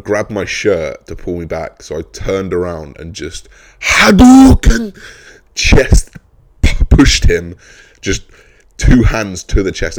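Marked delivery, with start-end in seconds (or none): 3.8-6.2 loud, slow game-move shout; Hadouken battle cry. Pretend. Pushing the attacker away, shouting the Hadouken move. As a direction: yell it like a fighting game attack.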